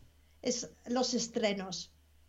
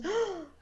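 startled gasp; 6 semitones higher than usual. Retold or imitated. Mimics a startled reaction to sneezing.

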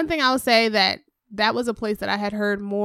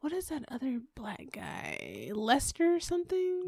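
hushed unvoiced question; whispering; 11 dB quieter than usual. Real, not acted. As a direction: whisper it, half to yourself. Trying to recall a name.

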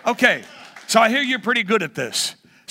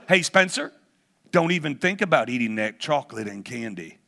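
ordinary delivery

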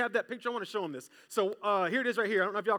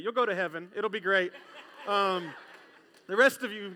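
ordinary talk

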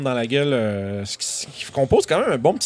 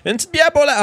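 much higher, louder innkeeper; 7 dB louder, faster than usual. Acted. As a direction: squeak out a cheery reply. Innkeeper offers beer.

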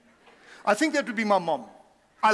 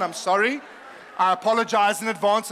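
normal talking voice

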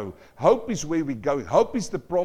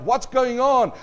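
high, louder call into the crowd; shouting blind man; 7 semitones higher, 6 dB louder than usual. Acted. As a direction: shout it out, confused. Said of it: "Then he shouted out".

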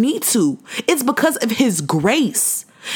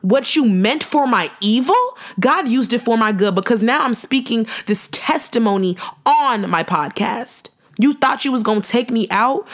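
ordinary talk, nothing marked

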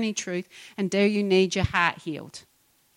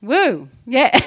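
ordinary talk, nothing marked

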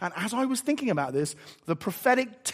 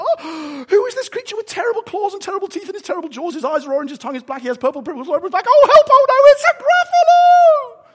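gasp, race through it, then scream the last words; terrified mouse; 13 semitones higher, 10 dB louder than usual. Acted. Mouse meeting the Gruffalo, terrified shriek.

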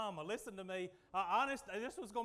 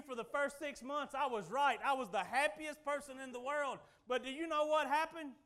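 ordinary talk, nothing marked

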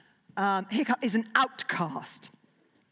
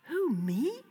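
squeaked; 5 semitones higher than usual. A narrator's reading. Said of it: "squeaked Hiccup".

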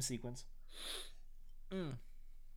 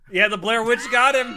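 excited; 7 semitones higher, 11 dB louder than usual. Real, not acted. Joking about frozen friend.

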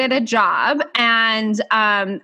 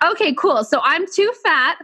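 higher-pitched, exasperated retelling; mock exasperation; 7 semitones higher than usual. Woman retelling being too fat and too tiny, mock exasperated. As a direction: let the pitch climb as you list the contradictions. Sarcastic retort to size judgments.

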